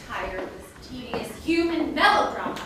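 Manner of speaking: rising, loud, high exasperation; exasperated. Acted. Ruler fed up with human melodrama, exasperated. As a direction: rant in regal irritation.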